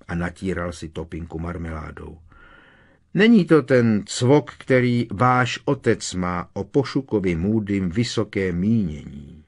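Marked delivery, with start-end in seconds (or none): 3.1-9.4 sharp, louder scolding mother; stern. A narrator's reading. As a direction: tell them off firmly. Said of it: "prohlásila paní Weasleyová přísně". (přísně a hlasitěji, jako kárající matka)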